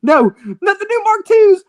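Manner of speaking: wailing in despair; anguished cry. Acted. Character despairs over lost engines.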